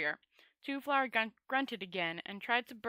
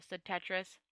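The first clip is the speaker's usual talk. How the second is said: ordinary delivery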